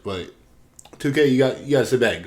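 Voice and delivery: ordinary talk, nothing marked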